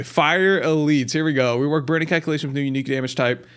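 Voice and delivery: ordinary delivery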